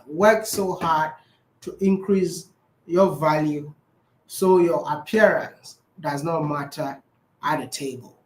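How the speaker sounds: slow, word by word, like dictating; slow dictation. Shown as a demonstration. Comedian dictates his quote slowly so it gets written down. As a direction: spell it out slowly so he can write. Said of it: "My brother, write it again".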